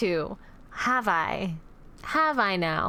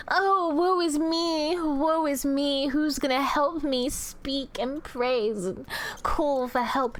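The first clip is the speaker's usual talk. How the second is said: mock woe; slower than usual. Demoness mocking her chat prisoners in hammed-up sorrow. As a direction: wail it in fake despair. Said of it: "mock lament".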